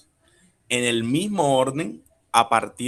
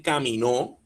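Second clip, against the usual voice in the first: "caminó" as ca-mi-NÓ, rising onto the last syllable; stress on final O; 2 semitones higher than usual. Shown as a demonstration. Teacher shows the past-tense form, "caminó" stressed on NÓ. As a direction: lift and lean on the final o of "caminó". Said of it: "la fuerza estaría en la O".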